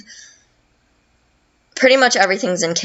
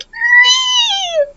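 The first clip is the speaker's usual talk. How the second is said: long, very high squeal; imitated monster screech. Bone Chill cries out in the fight, high imitated screech. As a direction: hold a thin, high creature screech.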